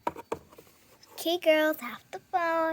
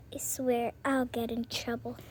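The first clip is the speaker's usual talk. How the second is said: whisper; 4 semitones lower, 7 dB quieter than usual. Acted. Aside to sibling.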